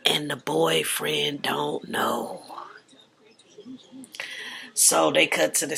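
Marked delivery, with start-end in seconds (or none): 0.1-2.4 slow, secretive whisper; spilling gossip to viewers in a slow whisper. Real, not acted.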